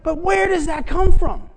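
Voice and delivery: high and loud rhetorical question; rhetorical call-out. Real, not acted. Preacher asking the congregation, high and loud. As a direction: fling the question out to the room.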